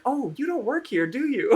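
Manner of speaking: very high-pitched customer voice; imitated embarrassed shopper. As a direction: squeak out the dawning realisation.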